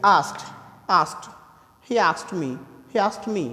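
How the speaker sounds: "asked" ending in a t sound, askt; slow "asked", the d said as t. Shown as a demonstration. Teacher showing students that asked ends in t. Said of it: "ट pronounce करते हैं".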